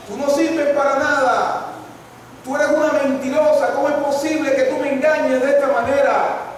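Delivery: a son lashing out at his mother; angry accusation. Retold or imitated. Hijo discute con la madre. (hijo furioso, gritando a su madre)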